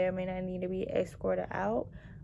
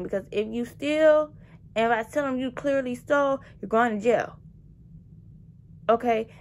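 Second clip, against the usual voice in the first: telling him off; 2 semitones higher, 7 dB louder than usual. Retold or imitated. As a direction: read him the riot act at closing time. Retold telling off of stalling customer.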